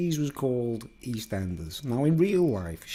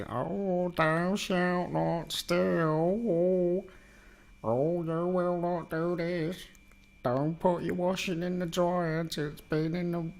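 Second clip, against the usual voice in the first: high, slow preachy sermon voice; mock preaching in a high voice; preachy character voice; 7 semitones higher, slower than usual. Imitating preachy soap character.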